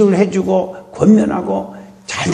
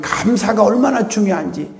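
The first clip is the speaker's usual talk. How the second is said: ordinary talk, nothing marked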